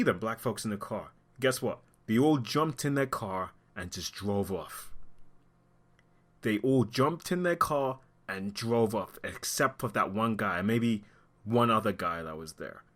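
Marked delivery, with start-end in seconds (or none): 6.4-9.2 slowed down, word by word; slow emphatic repeat. Real, not acted. Commentator stressing that bystanders left the body, slow and deliberate. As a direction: spell out the desertion slowly so it sinks in.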